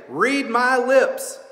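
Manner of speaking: slow, loud, emphatic politician vow; mock politician. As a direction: spell it out like a campaign promise. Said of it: "heard a politician say".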